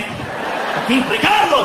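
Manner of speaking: high, dramatic swooping Spanish name; telenovela actor. Shown as a demonstration. Comic coaches soldier to sound like novelas, high dramatic delivery. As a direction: roll out "Ricardo" like a soap heartthrob. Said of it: "sound like you're in one of those novelas".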